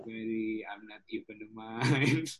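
slow, soft chanted affirmation; meditative mantra. Shown as a demonstration. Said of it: "And Isya Kriya".

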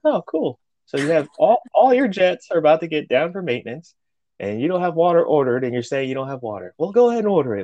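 lightly acted sarcastic telling-off; mock chief's reprimand. Retells the chief chewing them out.

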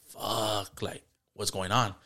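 softly, under the breath; a worried dad muttering to himself; stunned murmur. Retold or imitated. Seeing premature son first time.

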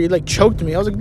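ordinary talk

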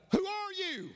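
very high, falling call; acted scream. Boy questioning the unseen voice, acted high scream. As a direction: holler it high across the mountains. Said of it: "he screamed out".

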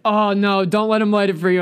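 friends yelling a warning, loud and high; mock warning shout. Friends warning him not to let the buddy light it, shouted. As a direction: call out the warning loudly.

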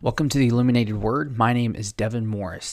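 ordinary talk

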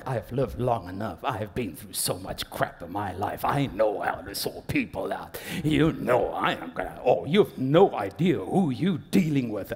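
low, hushed tough-guy menace; mock self-important boaster. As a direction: boast darkly, as if you run things. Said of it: "Because you go like".

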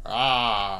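imitated human-sounding monster roar; grown man's roar. Rider mimics the new yeti, man-like roar. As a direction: roar like a man playing a beast. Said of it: "just a grown man".

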